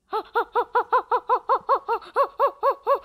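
quick string of high, pulsing whimpers; rapid high whimpering. Real, not acted. Player in a fight with an attacker, rapid high whimpering. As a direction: repeat a high little cry over and over. Wordless noise during game fight.

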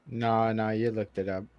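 low, soft deadpan accusation; quoting a comedy bit. Acted. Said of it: "Are you quoting the g".

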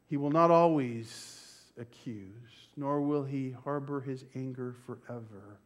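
ordinary talk, nothing marked

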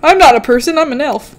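ordinary delivery

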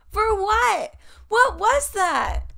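incredulous, slowed-down double take; can't believe what they're hearing; stunned disbelief. Real, not acted. Vlogger reacting in disbelief to the episode premise, incredulous.

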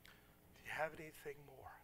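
soft, subdued imitated request; a touch of sorrow, gently imitated; retold tearful plea. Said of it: "She started crying".

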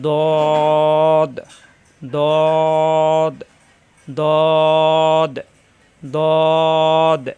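দদ said heavy from the tongue's side edge against the upper gums; thick, firm model দদ; Arabic letter dad. Shown as a demonstration. Teacher models heavy দদ for the class. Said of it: "দদের মাখরাজ".

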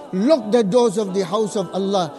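ordinary talk, nothing marked

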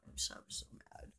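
real anger, whispered and very soft; furious whisper. Real, not acted. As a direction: hiss it under your breath, fuming. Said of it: "I was just so mad".

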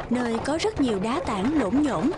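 usual speaking voice

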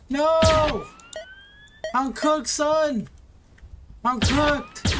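rising panic, sure he's done for; panicked. Real, not acted.

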